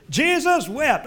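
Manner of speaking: put-on a child showing off his memory verse; proud kid recital. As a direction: rattle it off like a kid earning a gold star.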